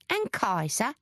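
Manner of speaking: pointed. A narrator's reading. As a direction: put it to them pointedly. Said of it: "Zig said pointedly".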